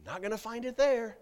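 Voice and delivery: loud, high-pitched preacher calling out news sources; fiery pulpit delivery; full-force preaching. Acted. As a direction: preach it hard to the congregation. Preaching on where to find truth.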